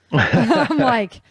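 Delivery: laughing through the words; a burst of real laughter. Real, not acted. Laughing while talking.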